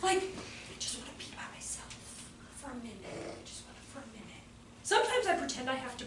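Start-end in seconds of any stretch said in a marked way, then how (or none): 0.2-4.4 exhausted mom whispering to herself; tired mother whispering; whispered. Retold or imitated. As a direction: breathe it out as a secret wish. Mom's secret wish for quiet.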